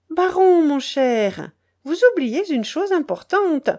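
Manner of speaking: high, lilting duchess voice; grand lady toying with her victim; teasing imperious duchess. Acted. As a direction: remind him sweetly who is in charge. Duchess toys with the reluctant baron.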